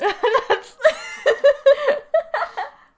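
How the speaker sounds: long loud high-pitched laughter. Real, not acted. Laughing at shared tears.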